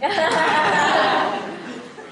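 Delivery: carcajada aguda; risa fuerte. Real, not acted. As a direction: suelta la carcajada. Ríe tras el chiste. (risa tras su propio chiste)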